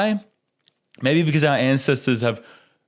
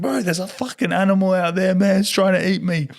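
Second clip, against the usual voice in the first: panicked; 8 semitones higher than usual. Retold or imitated. Panicked reply after diving in.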